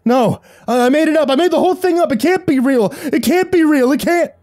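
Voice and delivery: high, loud, frantic pleading; panicked denial. A narrator's reading. Doomed boy denying the monster he made up, panicking. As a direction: babble it high and loud in terrified disbelief. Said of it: "died with that negation on his lips".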